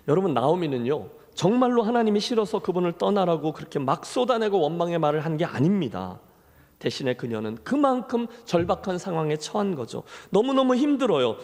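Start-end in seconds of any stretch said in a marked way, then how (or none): none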